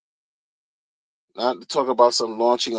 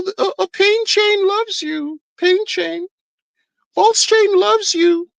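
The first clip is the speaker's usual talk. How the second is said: mocking cultist voice. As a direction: ham up a brainwashed crypto cult follower, much higher. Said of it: "Who speaks like that?".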